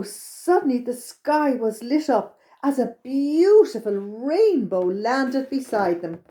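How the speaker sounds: ordinary delivery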